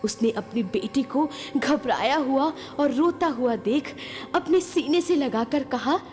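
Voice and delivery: ordinary delivery, nothing marked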